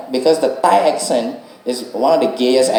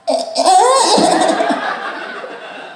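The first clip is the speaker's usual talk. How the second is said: high laugh. Real, not acted. Laughing at his own story.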